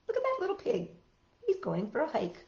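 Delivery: ordinary talk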